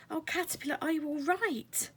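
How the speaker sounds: high, worried fairy voice. Acted. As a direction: ask anxiously.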